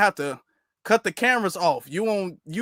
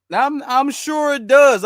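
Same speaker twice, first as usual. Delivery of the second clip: sarcastic, louder; 5 semitones higher, 6 dB louder, slower than usual. Real, not acted. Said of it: "sarcastic repetition louder".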